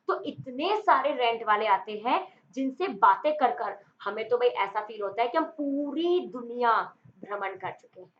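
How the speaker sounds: ordinary talk, nothing marked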